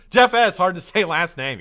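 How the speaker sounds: normal talking voice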